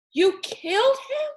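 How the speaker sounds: high, loud disbelieving cry; shocked outrage. A narrator's reading. Rob confronting his father over the dead tiger, high and outraged. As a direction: hurl it at him in horror.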